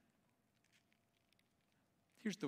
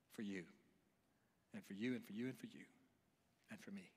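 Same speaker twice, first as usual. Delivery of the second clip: much lower and softer; hushed sincerity; 8 semitones lower, 11 dB quieter, faster than usual. Real, not acted. Preacher to each listener about the sacrifice, hushed sincerity. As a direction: drop right down, leaving space between each phrase.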